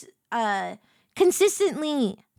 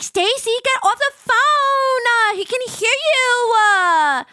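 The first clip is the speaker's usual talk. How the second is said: high, drawn-out mock teenage whine-yell; retold exasperated yell; 10 semitones higher, 6 dB louder, slower than usual. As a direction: yell at a friend to hang up, stretching the endings. Retold teen yelling at eavesdropping friend.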